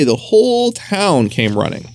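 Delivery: usual speaking voice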